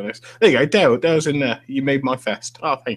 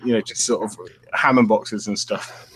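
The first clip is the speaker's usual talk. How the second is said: ordinary talk, nothing marked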